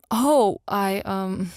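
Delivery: flustered, halting mumble; mumbling. A narrator's reading. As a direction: trail off awkwardly. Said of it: "Ken mumbles".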